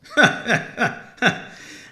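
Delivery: high, breathy Santa chuckle; jolly chuckle. Acted. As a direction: hmm building into a laugh. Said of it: "broke into a chuckle".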